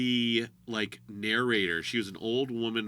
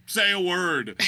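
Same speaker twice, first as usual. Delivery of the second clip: loud demand; 8 semitones higher, 7 dB louder, slower than usual. Retold or imitated. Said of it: "he's just like".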